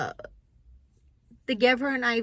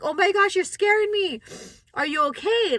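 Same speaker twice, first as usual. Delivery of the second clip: much higher, frightened plea; retold alarm; 8 semitones higher than usual. Mother to the fainted girl, alarmed and much higher. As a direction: pitch up in sudden panic for her.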